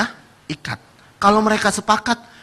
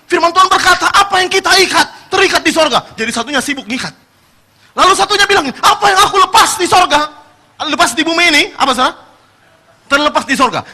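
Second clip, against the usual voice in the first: high, loud verse-shouting; mock dueling quotes; 10 semitones higher, 6 dB louder than usual. Two partners loudly quoting scripture at each other. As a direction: hurl the verse like a weapon.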